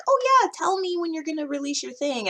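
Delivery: high-pitched supportive friend voice; imitating encouraging friends. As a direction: chirp it as an over-nice friend. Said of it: "helpful tribe mates that are like".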